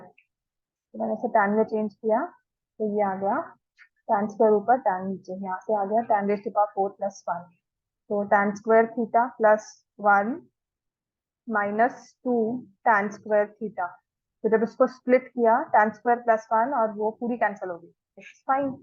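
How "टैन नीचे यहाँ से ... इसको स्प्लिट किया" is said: ordinary talk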